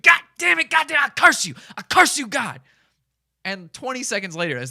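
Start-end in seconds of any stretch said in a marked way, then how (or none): 0.0-2.6 high, loud mock scream. Vlogger imitating Chris cursing God in the street, screaming. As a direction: curse God at the top of your lungs. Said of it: "Chris continues screaming".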